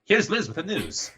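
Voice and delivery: 1940s radio announcer. Pretend. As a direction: introduce the news like an old wireless host. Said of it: "You should do it at nineteen forties".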